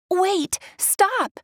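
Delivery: high urgent call out. A narrator's reading. Ariel calling after the octopus, urgent high call. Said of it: "Ariel shouted".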